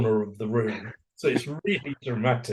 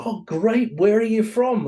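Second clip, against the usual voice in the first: high, bright, overjoyed greeting; delighted; 10 semitones higher, 4 dB louder than usual. Retold or imitated. New Yorker delighted by the American at the next table. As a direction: gush at finding a fellow American.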